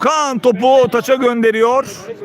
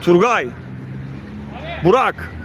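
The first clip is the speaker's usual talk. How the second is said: ordinary delivery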